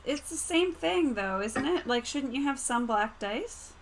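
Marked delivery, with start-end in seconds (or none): none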